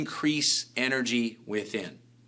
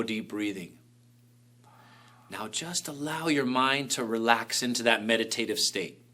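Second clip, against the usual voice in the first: guided meditation; 3 semitones lower, 5 dB quieter than usual. Acted. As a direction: lull them into calm. Leads a meditation.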